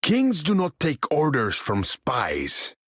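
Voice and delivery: slow, imperious put-down; proud king refusing orders; haughty rebuke. Acted.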